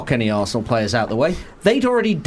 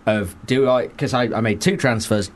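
usual speaking voice

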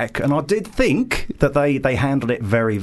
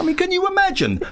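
high squeak. Real, not acted. Very high exclamation.

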